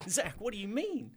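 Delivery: high, shocked disbelief. Acted. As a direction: gasp it out in shock. Mayor shocked by betrayal.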